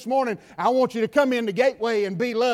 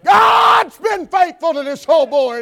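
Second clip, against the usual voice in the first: higher, louder declaration to God; shouted testimony; 6 semitones higher, 8 dB louder than usual. Pretend. Believer declaring to God, loud and high. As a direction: proclaim it back to God. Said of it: "you can look back at God and say".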